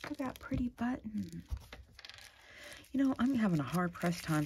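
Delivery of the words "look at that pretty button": softer, hushed echo of the delight; soft repeat. Real, not acted. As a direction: murmur it back to yourself. Repeats softly to herself.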